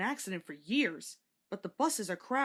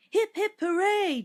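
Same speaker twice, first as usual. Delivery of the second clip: loud, high, slow chanted hooray; happy cheer; 11 semitones higher, 9 dB louder than usual. A narrator's reading. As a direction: cheer as the coach fills up. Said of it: "chanted Henrietta".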